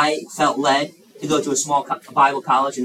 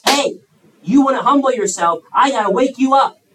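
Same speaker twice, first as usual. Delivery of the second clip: louder, higher hey wake up; mock wake-up call; 6 semitones higher, 5 dB louder than usual. God calling to a wayward child, loud wake-up. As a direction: rouse a sleeper with a sharp call. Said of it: "he says".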